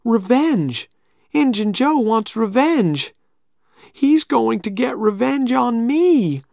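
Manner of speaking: high, slow frightened voice; worried Tom. Acted. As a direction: dread creeping in. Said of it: "Tom was very worried".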